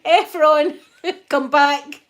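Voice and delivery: loud laughing call after Efron; loud, high laughing plea to come back. Real, not acted. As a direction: shout after him through a laugh. Chat user left, calling after them.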